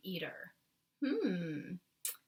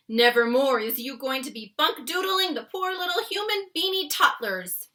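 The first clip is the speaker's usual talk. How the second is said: higher, loud giant vowing revenge; BFG's scolding voice; 6 semitones higher, 11 dB louder than usual. Acted. The BFG threatens the trapped nightmare, loud and stern. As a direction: tell the bad dream off, slowing at the end.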